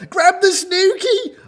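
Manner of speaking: loud, high-pitched bellow; excited, full-voiced animal cry. A narrator's reading. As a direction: yell it urgently across the moat. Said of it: "bellowed the seal and the gnu".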